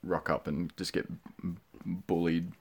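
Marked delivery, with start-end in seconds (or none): none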